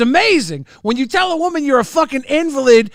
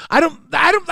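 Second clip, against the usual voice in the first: ordinary talk, nothing marked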